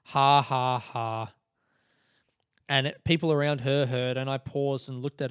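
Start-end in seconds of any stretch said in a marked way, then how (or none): 0.0-1.3 deadpan mock laugh, first ha hit hard; monotone, mocking spoken "ha, ha, ha"; flat sarcastic laugh. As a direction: imitate a sarcastic heckler laughing without amusement. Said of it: "Like, flat and sarcastic".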